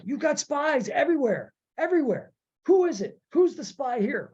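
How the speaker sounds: high, intense paranoid accusation; imitating Saw. Podcaster quotes Saw raving about spies, high and urgent. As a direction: voice Saw's frantic suspicion up high. Forrest Whitaker's character accusing.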